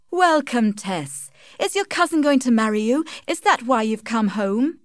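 high, lively mother's greeting; eager mother. Acted. Joan greets Tess arriving home, eager. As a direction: pitch it up with eager questions.

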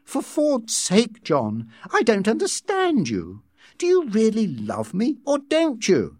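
high, exasperated demand for an answer; frustrated lover's plea. Acted. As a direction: push for an answer, voice climbing at the end.

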